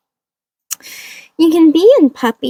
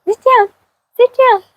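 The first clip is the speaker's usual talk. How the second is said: high-pitched firm sit command, rising; high dog command; 12 semitones higher, 4 dB louder than usual. Real, not acted. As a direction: tell the dog to sit, much higher and louder.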